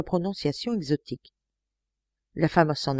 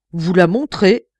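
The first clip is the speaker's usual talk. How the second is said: much louder outraged question; indignant outcry; 2 semitones higher, 9 dB louder than usual. A narrator's reading. Innkeeper outraged at Lecoq, loud indignant cry. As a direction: throw his request back at him. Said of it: "s'écria la blonde hôtesse indignée".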